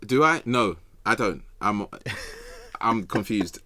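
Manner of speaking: ordinary talk, nothing marked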